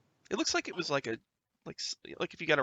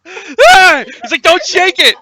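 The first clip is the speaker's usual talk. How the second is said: pained shout; 23 dB louder, slower than usual. Acted. Slapped wounded man.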